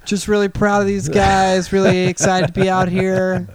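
high, breezy celebrity gushing; gushing red carpet chatter. Pretend. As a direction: gush to the cameras like a star. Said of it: "I can't do the fake hype".

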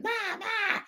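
high mock baby screech; screeching baby noise. Imitating the baby creature screeching at dinner. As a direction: squeal it like the creepy film baby.

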